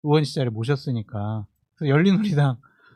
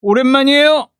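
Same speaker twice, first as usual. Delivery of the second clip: loud, high booming orator voice; Seo Young-kyo impression; 10 semitones higher, 9 dB louder than usual. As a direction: boom it like a speech from a soapbox. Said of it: "그걸 흉내내셨던 거죠".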